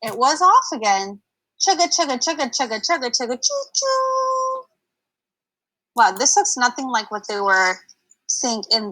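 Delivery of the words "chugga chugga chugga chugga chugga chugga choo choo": rhythmic chugga, high whistling choo; train noise. Acted. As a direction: chant like a steam train. Train sound effect.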